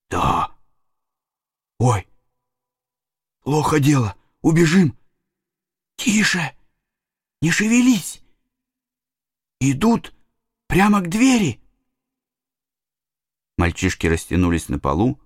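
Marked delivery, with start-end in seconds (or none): 0.1-11.6 frightened whisper. A narrator's reading. Boys panic hiding in house.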